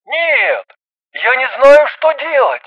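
high, helpless cry; panicked male caller; distraught. A narrator's reading. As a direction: plead helplessly, raising the voice. Distraught caller pleads for help.

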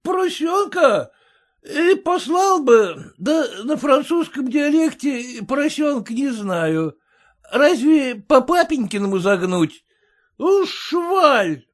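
loud, high tipsy bluster; drunk merchant. A narrator's reading.

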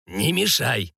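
curt order to back off; hushing rebuke. A narrator's reading. Sorcerer tells the cook not to interfere, hushing rebuke. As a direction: shush the meddler with restraint. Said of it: "цыкнул на него колдун".

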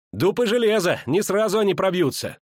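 high-pitched, relieved exclamation; excited exclamation. A narrator's reading. Girl exclaims after barring doors. (возбуждённое восклицание высоким голосом)

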